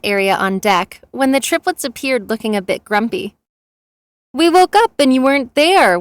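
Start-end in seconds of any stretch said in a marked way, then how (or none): 4.3-6.0 mild, higher-pitched whine; whining. A narrator's reading. Triplet complains to partner who left him sleeping, mildly whining. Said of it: "whined Calyx".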